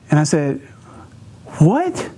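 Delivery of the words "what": surprised. Retold or imitated. Confused at 3 a.m. call.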